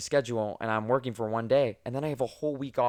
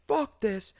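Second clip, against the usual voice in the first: much higher mock rant, a different voice; flipping out; 10 semitones higher than usual. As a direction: rant like a furious customer losing it. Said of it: "he fucking flips out".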